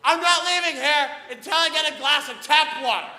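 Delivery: high, loud retold scream at the counter. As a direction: scream it like you've lost it. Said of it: "I'm screaming at someone".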